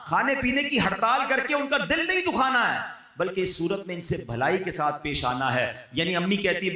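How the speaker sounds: ordinary delivery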